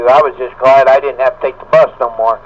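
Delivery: ordinary delivery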